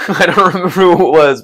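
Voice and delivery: amused, words tumbling out with laughter; laughing. Real, not acted. Streamer telling chat they forgot who, laughing. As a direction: talk through a laugh.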